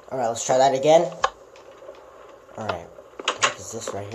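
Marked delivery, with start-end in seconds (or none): none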